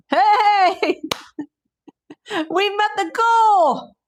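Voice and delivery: real joyful high shout; thrilled yell, stretched out slowly; excited. Real, not acted. Donation goal met live.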